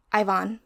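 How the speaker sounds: Ivan said as EYE-vahn; trying a pronunciation. Shown as a demonstration. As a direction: say Ivan with a long-I start and open "ahn". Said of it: "I don't know how to pronounce it".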